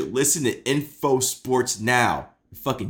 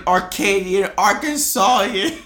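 loud mock Italian-American accent; raised-voice comic Italian accent; 6 semitones higher, 6 dB louder than usual. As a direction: proclaim it in a hammy Italian accent. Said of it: "I get to do my Italian accent".

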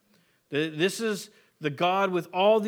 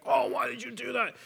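exasperated, higher-pitched scold; a mild imitated parental snap; frustrated parent scolding a child, mildly acted; mock upset parent; 7 semitones higher than usual. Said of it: "just been upset about something".

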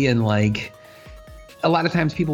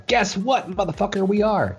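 excited; 8 semitones higher than usual. Acted. Playful taunt to listeners.

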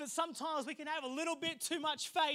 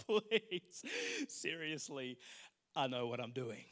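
smug laughing; 9 semitones lower, 8 dB quieter, slower than usual. Retold or imitated. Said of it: "I'm laughing and I'm thinking to myself".

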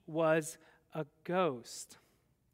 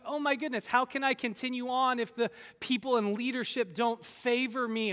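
usual speaking voice

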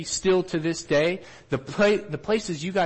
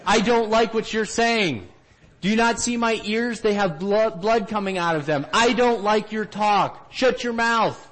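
imitated angry heckling; 8 semitones higher, 5 dB louder than usual. Listener who dislikes the teaching stands up, imitated angry shout. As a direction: shout them down like an offended listener. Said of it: "they'll also stand up and say".

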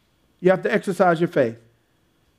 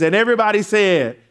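normal talking voice

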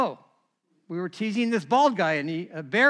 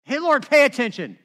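louder, higher bossy call to God; loud, higher, mock impatient; cheeky demand; 6 semitones higher, 7 dB louder than usual. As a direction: boss God around, tongue in cheek. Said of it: "I always think that's kind of funny".

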